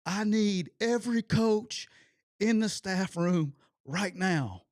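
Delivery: loud, high and slow, word by word; angry coach's summons. Retold or imitated. Head coach summons his staff after practice, retold angry call. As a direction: call out a furious order across the field.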